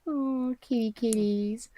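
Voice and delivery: soft, slow coo; cooing at kitties. Real, not acted. Cooing tenderly over the kitties, soft and slow. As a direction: croon gently to the cats.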